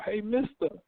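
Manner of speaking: lightly voiced kid pitching a car wash; hustling kid. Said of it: "little kids out there talking about".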